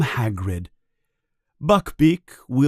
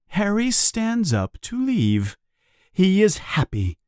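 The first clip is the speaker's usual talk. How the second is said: plain reading voice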